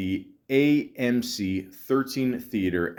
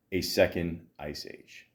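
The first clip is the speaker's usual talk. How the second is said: ordinary talk, nothing marked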